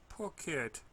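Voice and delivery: ordinary delivery, nothing marked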